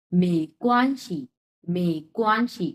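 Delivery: "মে কোয়ানশি" slowly, "শি" lightly released; careful tone model. Shown as a demonstration. Teacher shows how it is pronounced, slow model. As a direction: separate "মে" and "কোয়ান", let "শি" go. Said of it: "এটার উচ্চারণ হবে হচ্ছে".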